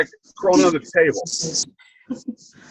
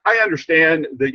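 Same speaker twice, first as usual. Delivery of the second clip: ordinary talk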